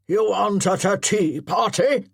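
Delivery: loud impatient growl. Acted. Colonel scolding Chatton's timid rush, growling loudly. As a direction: growl it at a slack recruit. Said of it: "growled Colonel Patterson".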